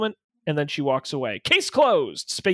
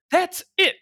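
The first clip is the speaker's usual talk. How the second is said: angry outburst; 11 semitones higher than usual. Acted. Secretary quits in anger.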